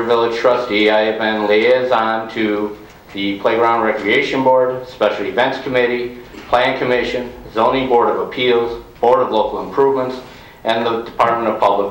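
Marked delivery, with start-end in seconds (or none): none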